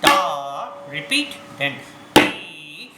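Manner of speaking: slow, spaced tabla bols; slow recitation. Shown as a demonstration. Teacher recites tabla line slowly for children. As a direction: recite each bol slowly with the drum. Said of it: "speak and play also".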